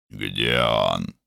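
low, stern demand; stern question. A narrator's reading. Older brother asking the family after Varun, stern. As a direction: ask it low and curt.

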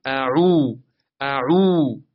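اعو with عو held two counts; two-count stretch. Shown as a demonstration. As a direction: stretch the final long u of اعو. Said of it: "باید به اندازهٔ دو حرکت بکشید". (عو را دو حرکت بکش)

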